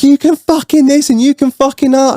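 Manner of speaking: high-pitched mocking imitation of a ranter; mock outraged blustering; imitated angry rant. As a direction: mimic her mouthing off. Woman's ranting imitated.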